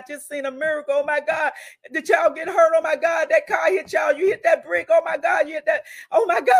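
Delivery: high, loud, astonished shouts; retelling a stunned witness yelling in the rain; excited shouting. Retold or imitated. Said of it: "He said".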